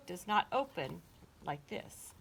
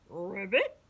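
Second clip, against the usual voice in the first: little pig ribbiting; 5 dB louder than usual. A narrator's reading. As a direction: croak with a questioning rise. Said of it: "practicing his".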